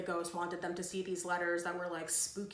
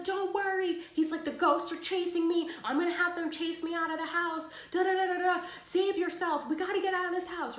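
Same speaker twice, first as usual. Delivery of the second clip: much higher and louder, frantic; panicked friend; 10 semitones higher, 6 dB louder than usual. Retold or imitated. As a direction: squeal it like he's fleeing ghosts. Friend voiced fleeing ghosts.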